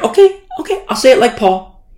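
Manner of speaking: high, loud mock-placating okay; mock concession. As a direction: give in with exaggerated exasperation.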